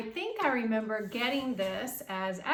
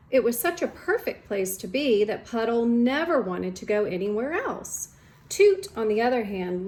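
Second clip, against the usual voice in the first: lively, louder storybook read-aloud; reading to children; 7 dB louder than usual. Acted. Teacher reading a picture book to students, lively storytime voice. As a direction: read it brightly for the class.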